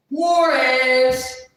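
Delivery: loud, high, sung-out name; triumphant reveal. Real, not acted. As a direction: belt the name out like a big reveal. Reveals who called back.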